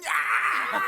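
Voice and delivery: loud, high "yeah" roar; mock crowd cheer. Fan imitating Everton crowd winning a throw-in, mock cheer. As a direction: cheer like fans thrilled by a mere throw-in.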